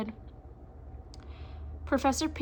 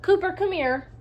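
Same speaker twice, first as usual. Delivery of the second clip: calling out; 8 semitones higher, 5 dB louder than usual. Acted. Character calls out.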